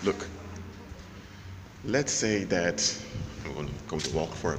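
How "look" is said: ordinary delivery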